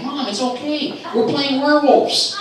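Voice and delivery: put-on high, slow child voice; reassuring son. Son explaining the game to mom, high, slow reassurance. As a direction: draw it out in a high little-boy voice.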